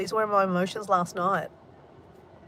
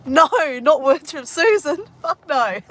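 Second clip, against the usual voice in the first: laughing; 7 semitones higher, 9 dB louder, slower than usual. Real, not acted. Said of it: "laughs, cry".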